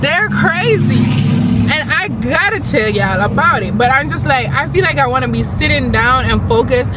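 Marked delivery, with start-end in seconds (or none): 0.0-3.8 they are crazy, hit it hard; excited rant. Real, not acted. Excited rant about nail people.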